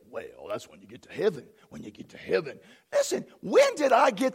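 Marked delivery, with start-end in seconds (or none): 0.1-2.6 low, hushed, mumbled imitation of doubters; quietly mocking quote; dismissive mimicry. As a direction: mimic people putting it off, low and soft. Mimics people putting off blessings.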